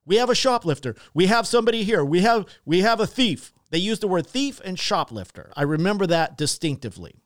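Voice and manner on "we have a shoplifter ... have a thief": higher, louder mock scream across the store; screaming accusation. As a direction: yell it to humiliate him in front of everyone. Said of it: "They were screaming that".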